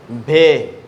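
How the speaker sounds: भे called out loud with a breathy bh; loud bhe for the class. Shown as a demonstration. Teacher leads kids in unison, loud aspirated bhe. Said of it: "सब बच्चे मेरे साथ साथ पढ़ेंगे".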